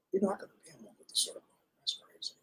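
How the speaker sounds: hushed confiding whisper to the listener; whispered aside. Real, not acted. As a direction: drop to a whisper for a private aside. Whispered aside mid-story.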